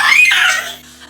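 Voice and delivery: high, loud shrieking scream; imitating partner's speed-bump scream; mock scream. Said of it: "she was like".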